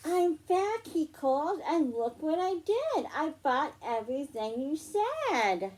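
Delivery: bright storybook read-aloud; reading to children. Acted. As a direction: read it like story time for little girls. Reads picture book to children.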